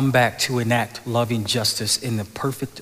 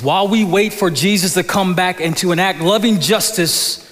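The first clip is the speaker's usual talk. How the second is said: loud, higher, full-force preaching; preaching climax; 8 semitones higher, 6 dB louder than usual. Real, not acted. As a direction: lift the whole room with it. Sermon climax over music.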